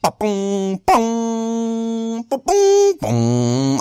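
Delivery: beatbox a quick beat to dodge copyright music. Shown as a demonstration. Vlogger beatboxing as his intro music, punchy booms. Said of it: "I'm beatboxing this".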